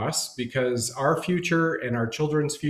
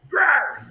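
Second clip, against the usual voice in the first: loud, high mock growl; sudden playful creature roar; imitated beast growl; 12 semitones higher, 6 dB louder than usual. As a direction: growl like a lunging raptor. Imitating the velociraptor lunging.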